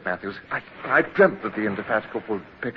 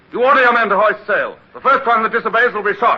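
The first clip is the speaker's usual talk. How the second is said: loud, higher barked order at gunpoint; commanding threat; 10 semitones higher, 7 dB louder than usual. Acted. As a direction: force him to obey, full command. Holding fisherman at gunpoint.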